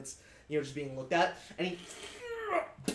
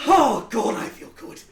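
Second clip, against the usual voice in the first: very loud laughing, then breathy I feel good; exultant laugh; 11 semitones higher, 13 dB louder, slower than usual. Acted. Captain revelling in his kill, loud laughing.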